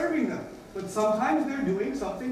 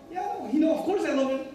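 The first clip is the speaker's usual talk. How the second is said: higher, brushing-off protest; lightly acted defensive dad, pitched up; mildly defensive; 6 semitones higher, faster than usual. Father asked if he tells his boy he loves him, mildly defensive.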